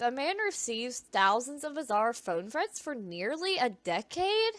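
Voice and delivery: usual speaking voice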